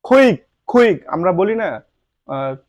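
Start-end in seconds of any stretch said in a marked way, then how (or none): 0.0-1.1 "কুইক" with aspirated kh; correct pronunciation. Shown as a demonstration. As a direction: puff the k of "কুইক". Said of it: "যেমন".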